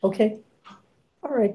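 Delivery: normal talking voice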